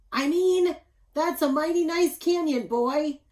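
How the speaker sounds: loud, high father fixing his words; flustered correction. A narrator's reading. Father corrects his slip to his son, loud and high. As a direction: blurt the fix in a hurry, booming. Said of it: "Pa Bunyan got tongue-tied and said".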